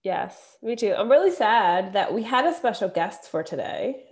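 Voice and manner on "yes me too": ordinary talk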